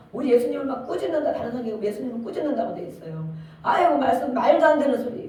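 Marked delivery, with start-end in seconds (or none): 3.6-5.3 scoffing dismissal. Retold or imitated. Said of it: "우리가 막 그러죠".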